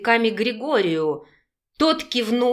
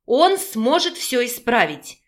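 usual speaking voice

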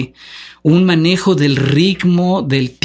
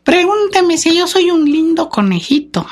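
Titino puppet voice. Retold or imitated. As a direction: squeak it out like a ventriloquist's dummy. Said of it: "le decía Titino".

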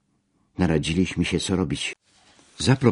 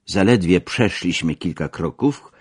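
ordinary delivery, nothing marked

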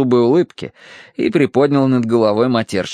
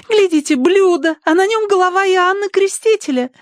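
very high, thrilled squeak; Tossie. A narrator's reading. Tossie spotting the Baptist's head, thrilled gushing. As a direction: point it out in sky-high delight. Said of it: "не унималась Тосси".

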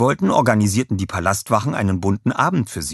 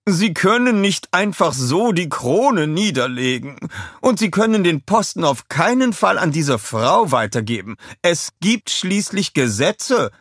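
shouted protest; 10 semitones higher, 5 dB louder than usual. A narrator's reading. Royal adviser forbidding the crown handover, shouted protest. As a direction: insist indignantly, stretching the key words. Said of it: "schrie er".

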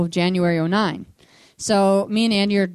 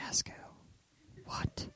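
bewildered whisper, barely voiced; hushed, incredulous whisper; whispered question; 18 dB quieter, much slower than usual. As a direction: whisper back in disbelief, acted out. Whispering back to God in prayer.

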